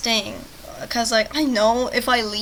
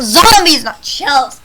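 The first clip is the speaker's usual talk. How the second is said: annoyed correction; 3 semitones higher, 13 dB louder, much slower than usual. Real, not acted. Annoyed correction of game's term.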